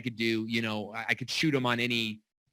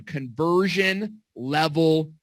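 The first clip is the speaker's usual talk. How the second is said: slow and deliberate, word by word; dictating each word slowly; slow dictation; much slower than usual. Shown as a demonstration. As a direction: spell it out so they can write it down. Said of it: "Okay, write that down".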